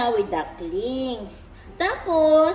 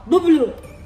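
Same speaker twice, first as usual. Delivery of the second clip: loud word to copy; 8 dB louder than usual. Shown as a demonstration. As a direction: say "Bublo" loudly and clearly for the baby to repeat. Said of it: "say mo". (malakas na "Bublo" para gayahin ng bata)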